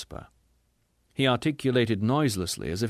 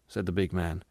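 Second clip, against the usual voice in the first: usual speaking voice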